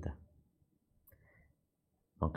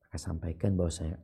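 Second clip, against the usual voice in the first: ordinary delivery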